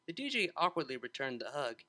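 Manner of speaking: usual speaking voice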